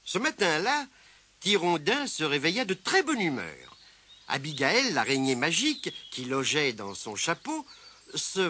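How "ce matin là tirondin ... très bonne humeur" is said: ordinary delivery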